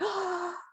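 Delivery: sharp, high joyful gasp; thrilled intake of breath; delighted gasp. Pretend. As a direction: gasp as if the song just came on. Delighted gasp at favourite song.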